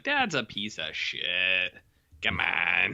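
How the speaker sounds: lightly voiced bitter brother, slowed down; blunt brother. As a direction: drag it out low like a fed-up brother. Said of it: "And the brother's like".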